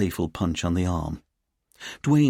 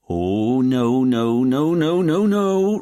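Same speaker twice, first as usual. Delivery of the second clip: long run of no's climbing higher and louder; rising alarm; 6 semitones higher, 6 dB louder, much slower than usual. Acted. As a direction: build from worry to loud high panic. Sees boss's officer chatting, races up.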